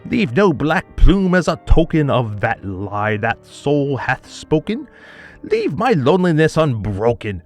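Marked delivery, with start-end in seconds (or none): none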